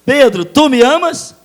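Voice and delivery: loud, high calling question; Jesus asking Peter. Acted. Jesus pressing Peter, loud and high. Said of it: "é três vezes".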